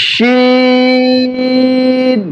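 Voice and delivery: full-mouthed shin with a very long held vowel; shin articulation demo. Shown as a demonstration. Teacher showing students shin with a full mouth, elongated demo. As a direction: stretch shin out on one high, level note. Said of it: "মুখটা ভরে উচ্চারণ করব".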